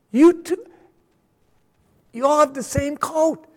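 man stepping into heaven greeting friends, high and delighted; excited greetings. Pretend. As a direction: wave across to old friends.

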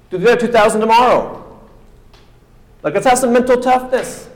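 preacher mimicking a boss pushing a tired worker; mock coach push. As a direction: bark it like a drill coach.

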